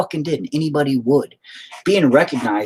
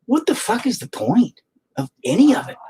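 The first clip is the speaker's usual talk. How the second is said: exasperated inner voice; 10 semitones higher than usual. Retold or imitated. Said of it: "I'm just like".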